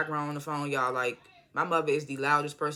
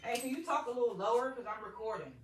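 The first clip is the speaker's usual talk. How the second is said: higher, softer voice from a distance; calling off-mic; 7 semitones higher, 8 dB quieter than usual. Real, not acted. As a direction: turn away and ask someone in the background. Turns away to ask mother.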